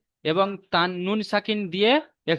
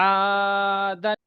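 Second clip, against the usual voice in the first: slowly stretched word; much slower than usual. Shown as a demonstration. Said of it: "আমি পড়ে দিচ্ছি".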